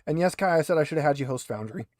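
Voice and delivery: ordinary talk, nothing marked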